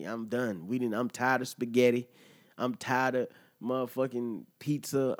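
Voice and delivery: normal talking voice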